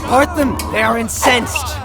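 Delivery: loud order to break them up; commanding shout. Acted. King to courtiers, parting the fighters, loud command. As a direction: bellow across the hall.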